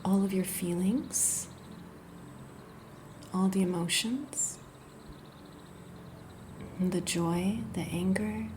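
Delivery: unhurried, gentle body-scan guidance; guided meditation. Acted. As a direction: ease the listener into rest, phrase by phrase. Leads a guided yoga nidra.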